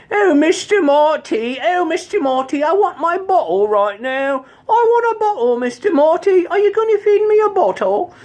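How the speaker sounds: very high British character voice; British puppet accent. Acted. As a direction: demand it in a shrill Cockney puppet accent. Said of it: "gonna love my accent".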